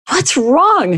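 high alarmed shriek. Acted. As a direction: cry out in panic. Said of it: "she shrieked".

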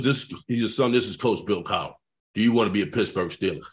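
low, matter-of-fact coach voice; imitated coach. Coach phoning a draft pick, low and authoritative. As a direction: drop low to play the head coach.

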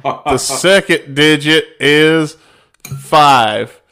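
slow, loud, deliberate digit dictation; dictating. Retold or imitated. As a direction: spell out each number slowly.

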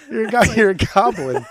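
spluttering through laughter; laughing. Real, not acted. Laughing at his own goblin insult, spluttering. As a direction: try to land the insult but crack up.

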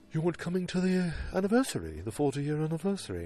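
high-pitched British caricature; mock British accent. [name] questions Cooper about anniversary, high mock British accent.

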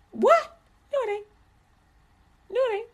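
squeaky high, quick denial; comic falsetto objection; mock disbelief. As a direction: pipe up incredulously, then soft high denials. Mock incredulous reply to quoted argument.

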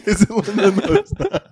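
high proud father calling out. Acted. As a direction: call the wife downstairs. Proud dad calling wife downstairs.

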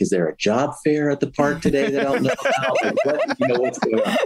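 disbelieving question, laughing through it; laughing sarcasm. Retold or imitated. Telling his wife the park plan makes no sense, laughing. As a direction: scoff at the idea of the park while laughing. Mocking wife's park idea.

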